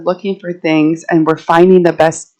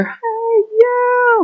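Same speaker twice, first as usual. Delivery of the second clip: high, sharp call of a neighbor catching a trespasser; mock yell. Neighbor spotting a forager in his yard, high mock yell. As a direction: call out high like you caught someone. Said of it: "And I hear".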